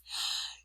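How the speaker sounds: sharp excited gasp; sudden thrilled intake of breath. Shown as a demonstration. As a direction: gasp in delight. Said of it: "You could have read it like this".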